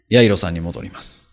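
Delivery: ordinary delivery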